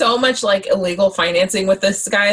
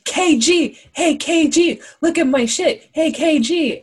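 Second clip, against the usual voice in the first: much higher-pitched, drawn-out hailing; excited hustler calling out; 9 semitones higher, slower than usual. Acted. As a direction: call out insistently in a high, eager impression. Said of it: "remember what he calls him. He's like".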